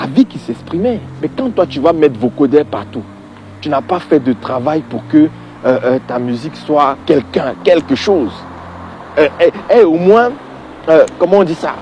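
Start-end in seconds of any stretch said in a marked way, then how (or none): none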